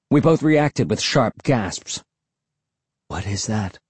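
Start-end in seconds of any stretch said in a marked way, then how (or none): 3.1-3.8 soft, hushed question; whispered. A narrator's reading. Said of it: "I whispered".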